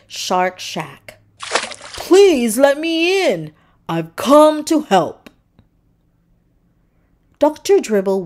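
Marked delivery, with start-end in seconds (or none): 1.4-5.3 slow, higher, pleading; gentle plea. A narrator's reading. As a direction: coax her to open up. Pleading at the door.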